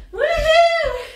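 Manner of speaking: high, loud whoop of joy; cheering woohoo. Real, not acted. Instructor cheering the class through jumping jacks, loud excited whoop. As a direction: cheer the class on at the top of your voice.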